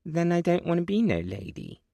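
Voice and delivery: put-on high, defiant child; a little girl refusing; small girl voice. A narrator's reading. Little girl answers her great-grandmother, high defiant child.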